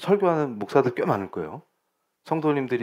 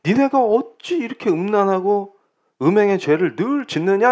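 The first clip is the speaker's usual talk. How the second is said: high-pitched quoted reproach; forceful prophetic rebuke; 6 semitones higher than usual. Retold or imitated. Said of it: "강력하게 얘기한 게".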